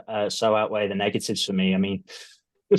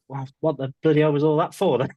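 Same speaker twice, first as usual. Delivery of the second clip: exasperated; 6 semitones higher than usual. Retold or imitated. Exasperated quote to himself.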